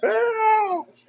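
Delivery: high, far-off screamed cry of help; hollering for help. Retold or imitated. Derek outside in a panic, hollering for help. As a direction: yell it out in a panic. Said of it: "He's outside hollering".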